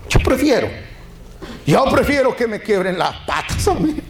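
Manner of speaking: high-pitched, emphatic preaching; fervent declaration. Real, not acted. Preacher declaring his own choice to the congregation, fervent and high. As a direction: push it up high with conviction.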